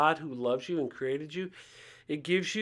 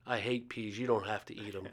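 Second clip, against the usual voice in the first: put-on softer conspiratorial murmur, just for the boy; quiet aside; 4 semitones lower, 8 dB quieter than usual. Commander to the little boy, a softer secret aside. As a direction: say it softly, like a secret between you and the kid.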